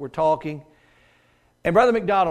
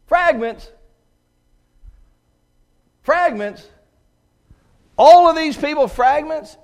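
louder, higher, incredulous repeats; 9 semitones higher, 7 dB louder, slower than usual. Acted. Preacher acting disciples' disbelief at fragments, incredulous.

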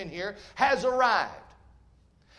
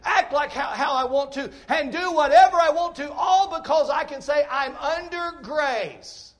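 louder, higher, rising preacher's cry; preaching at full force; 4 semitones higher, 5 dB louder than usual. Acted. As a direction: hammer each "want to" home to the congregation. Preacher builds to shouting.

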